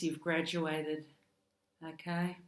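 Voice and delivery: ordinary delivery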